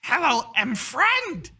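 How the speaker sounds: high, loud, slow greeting; friendly kobold. Acted. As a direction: greet in broken speech as a little creature. Kobold greets in broken common speech.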